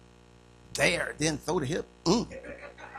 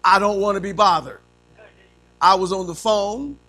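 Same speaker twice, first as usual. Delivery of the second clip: louder, put-out complaint; irritated child; 7 dB louder than usual. Acted. Child disturbed at play by a parent, irritated complaint. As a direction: protest each interruption, louder and annoyed. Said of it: "what does the first yes say?".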